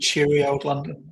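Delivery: put-on Mary Poppins British accent. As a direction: sing out London like a chirpy Mary Poppins Brit. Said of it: "That's a bit Mary Poppins".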